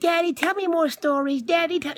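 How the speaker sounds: put-on high, sweet little-girl voice. Dad imitating his daughter as a small kid, high and pleading. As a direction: beg like a small child wanting stories. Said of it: "when she was a kid".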